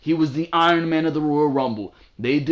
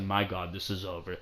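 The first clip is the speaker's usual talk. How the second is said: hushed dismay; 6 semitones lower, 11 dB quieter than usual. Retold or imitated. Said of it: "and I said".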